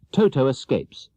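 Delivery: ordinary delivery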